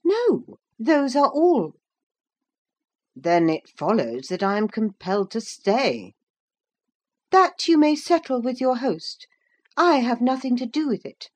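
high-pitched, dismissive woman's voice; haughty young woman. Acted. Young woman disowning the guest's problem, haughty.

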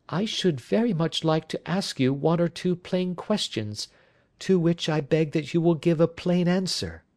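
high-pitched lady pressing for honesty; anxious wife, insistent. Acted. Wife begs the detective for plain answers, high insistent voice.

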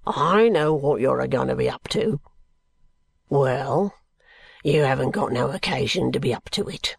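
low, rough-spoken working man, defiant; low-pitched, dialect-heavy, contemptuous brickmaker; surly drunken brickmaker. Acted. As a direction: growl out a sullen answer to an unwelcome visitor. Brickmaker's rant voiced within the narration.

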